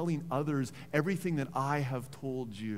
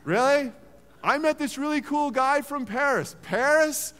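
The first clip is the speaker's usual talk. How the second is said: scoffing; 11 semitones higher, 9 dB louder than usual. Retold or imitated. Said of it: "being like".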